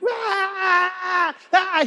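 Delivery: high, drawn-out mock squeals; shrieking like a hysterical fan; mock fan screaming. What no one does on seeing him in the street, mock fan screams. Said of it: "no one's like".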